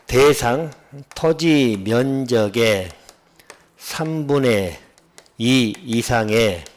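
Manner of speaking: very slow, measured phrase-by-phrase dictation; slow recitation. Shown as a demonstration. Teacher reciting the statute's wording for students, slow and deliberate. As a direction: spell out the clause slowly, pausing between phrases.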